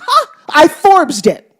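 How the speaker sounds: winner's delighted gasp, drawn out; triumphant gasp. Retold or imitated. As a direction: gloat over the win with a gasp. Woman wins the raffle.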